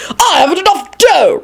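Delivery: high-pitched, outraged cry; bellowed. A narrator's reading. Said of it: "Richard bellowed".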